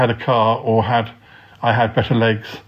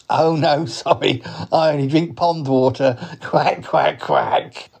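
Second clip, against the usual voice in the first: slightly raised cartoon duck voice; talking duck; 4 semitones higher than usual. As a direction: play a duck politely declining. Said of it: "he is a duck".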